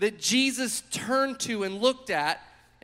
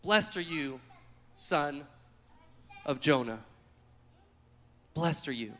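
lower, softer, tender blessing; 7 semitones lower, 6 dB quieter than usual. Acted. Jesus blessing Peter again, soft and tender. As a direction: say it gently, almost to yourself.

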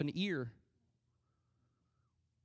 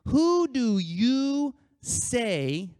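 loud, high, drawn-out WHO DO YOU SAY; pointed question; 7 semitones higher, 6 dB louder, much slower than usual. Retold or imitated. Jesus asking his disciples who he is, pointed question. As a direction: press each word of "Who do you say" slowly and loudly.

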